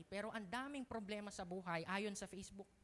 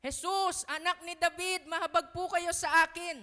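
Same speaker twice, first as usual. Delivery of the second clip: higher, much louder cry for mercy; loud plea; 8 semitones higher, 12 dB louder than usual. Shown as a demonstration. Preacher showing how to approach Jesus, loud pleading cry. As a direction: beg Jesus at full voice. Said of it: "Imbes na".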